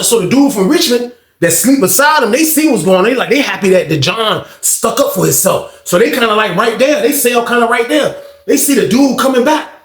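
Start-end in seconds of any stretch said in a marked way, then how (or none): none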